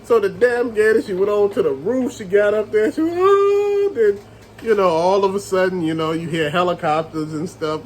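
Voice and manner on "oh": high-pitched drawn-out mock wail; mimicked high cry. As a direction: imitate her crying out from the roof. Imitates heroine's cry on roof.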